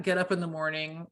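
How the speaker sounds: normal talking voice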